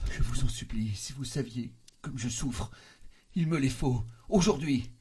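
high, anguished begging; implore, building to a loud cry; desperate plea swelling at the end; tearful pleading. A narrator's reading. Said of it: "Pleurait et bégayait".

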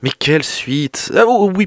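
ordinary delivery

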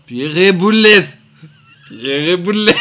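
[name] traîné, plus aigu et fort, comme une bande déformée; bande repassée à l'endroit. Shown as a demonstration. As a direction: imiter l'enregistrement inversé puis remis à l'endroit. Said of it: "dès que j'entends le mot [name]". (voix de bande magnétique déformée)

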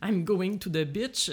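put-on slow English in a thick French accent. Average Frenchman speaking English, exaggerated French accent, slowly. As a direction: caricature a French speaker struggling through English. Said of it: "On reconnaît qu'il est français".

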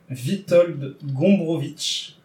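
slow attempt at a Polish accent on the name. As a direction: say Witold Gombrowicz slowly in a Polish accent. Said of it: "vous excuserez mon accent polonais".

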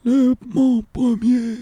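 put-on very high, very slow old riddler; Père Fouras. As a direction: draw out the riddle like the old sage. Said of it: "Ah, Père Fouras". (voix de vieux sage très aiguë et lente)

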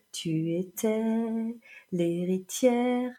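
slow, drawn-out mimicked lyric with a long gap; imitating lilting singer. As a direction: stretch each phrase out as the singer does, pausing between. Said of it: "raccrocher les wagons entre deux phrases". (imitation lancinante, syllabes étirées)

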